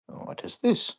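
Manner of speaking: ordinary talk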